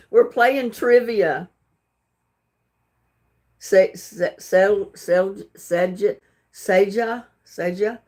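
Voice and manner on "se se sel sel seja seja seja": halting false starts before Seja; unsure stabs at Seja, piece by piece; struggling to pronounce. Real, not acted. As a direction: try Seja in broken bits, restarting. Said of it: "I can't pronounce that name".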